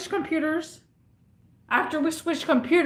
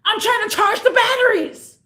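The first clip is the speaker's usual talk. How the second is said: imitating a customer's high, loud yell; retold yelling; 10 semitones higher, 5 dB louder than usual. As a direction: holler it as the exasperated customer. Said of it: "So he yells at me".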